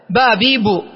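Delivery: با، بي، بو as ba, bi, bu; three vowels on ba. Shown as a demonstration. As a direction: sound out با، بي، بو one syllable at a time. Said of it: "والباء مثلثة".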